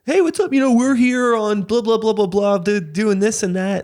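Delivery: high singsong, running on through the blahs; mock self-promoter spiel. A performer plugging himself to a stranger, mock-chummy. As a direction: imitate someone plugging themselves, pitched high.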